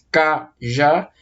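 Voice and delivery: "cajá" as two separate beats, ca, já; split into syllables. Shown as a demonstration. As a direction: pause between the syllables of "cajá". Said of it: "eu divido em duas sílabas".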